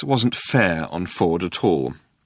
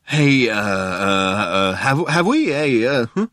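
flustered stammer with drawn-out uhs; awkward surprise; slower than usual. A narrator's reading. Zaphod caught out by Arthur, awkwardly surprised. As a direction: stall and fumble, pitch climbing. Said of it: "gave an awkward start of surprise".

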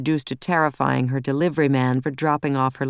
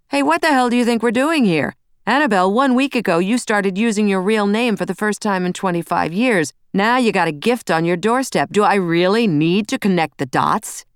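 heated arguing; 6 semitones higher, 4 dB louder than usual. A narrator's reading. Partner argues heatedly with her.

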